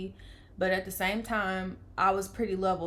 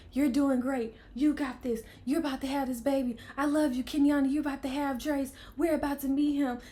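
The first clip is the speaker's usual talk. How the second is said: lightly higher, cheering-on voice; mock pep talk, pitched up; encouraging onlookers; 5 semitones higher than usual. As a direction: imitate loved ones urging her on. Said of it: "everybody's, like, coming in the spotlight like".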